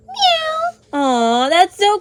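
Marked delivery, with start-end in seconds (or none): 0.1-0.8 very high kitten mew; cat meow. Pretend. Showing the toy that is a cat, high meow. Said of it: "This one is a cat".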